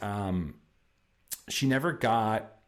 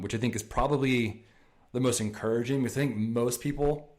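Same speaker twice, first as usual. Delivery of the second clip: ordinary talk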